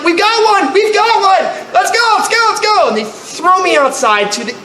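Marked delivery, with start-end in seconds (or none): 0.0-2.9 high, urgent shouting of orders; rally the crew in a hurry; hammed-up emergency rush, pitched high; urgent call. Responders rushing, retold.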